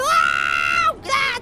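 loud, sudden yelp; startled yell. Real, not acted. Player hit by a cow, startled yell. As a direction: yell out as if just struck.

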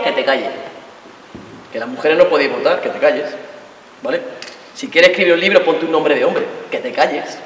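put-on boyfriend-style macho shushing; mock macho voice. Voicing patriarchal silencing.

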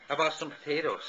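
normal talking voice

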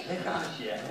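ordinary delivery, nothing marked

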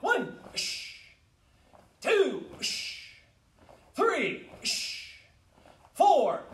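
high, loud counts, spaced out; shouted drill count. Real, not acted. Teacher counting high blocks for the class, shouted counts. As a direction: call each number out sharply.

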